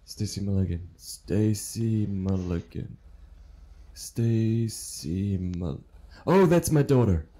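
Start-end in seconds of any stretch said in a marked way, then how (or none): 0.1-5.8 slow puzzled repeat. Acted. Slow puzzled repetition of name.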